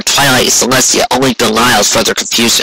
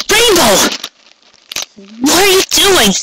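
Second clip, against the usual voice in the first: much higher, urgent alarmed voice; alarmed cry. A narrator's reading. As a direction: cry out that she has it wrong. Character cries out at Rainbow.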